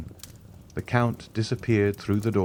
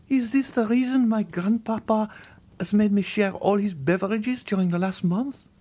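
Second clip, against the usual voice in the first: put-on high, pleading young woman's voice; a terrified girl refusing to believe; frightened young girl; 13 semitones higher than usual. A frightened young Valentine begging the Count to leave her.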